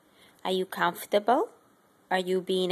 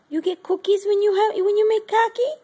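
much higher and louder, squealing pet talk; 11 semitones higher, 6 dB louder than usual. Real, not acted. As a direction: rev the pet up with high squeals. Excited baby talk to pet.

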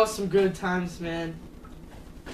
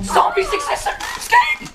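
very high airy squeal; squeaky panic; 6 dB louder than usual. Pretend. Panicked fleeing in game.

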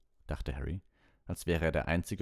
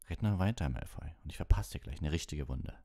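usual speaking voice

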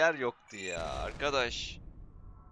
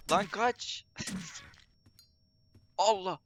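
being chased; 10 semitones higher, slower than usual. Real, not acted. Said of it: "beni kovalıyor".

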